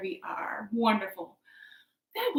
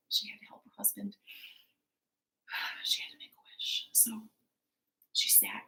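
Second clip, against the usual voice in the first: near-whispered, sighing storytelling; hushed narration; 3 semitones lower, 12 dB quieter, faster than usual. A narrator's reading. Storyteller as the wife reluctantly sits to wish, hushed. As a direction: drop almost to a whisper, heavy with a sigh.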